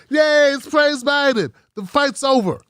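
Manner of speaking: high, slow sarcastic cheering; mock cheer. Mocking Biden supporters with a fake, slow cheer. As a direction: parody a gloating supporter, drawn out. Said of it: "and then you go".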